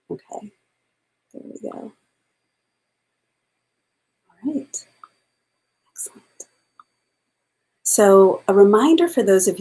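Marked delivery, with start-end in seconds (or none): 0.1-6.2 very soft, half to oneself; quiet aside. Real, not acted. Teacher checking one logistical thing, quiet aside. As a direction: murmur it while busy checking something.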